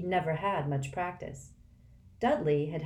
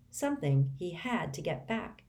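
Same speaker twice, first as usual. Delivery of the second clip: usual speaking voice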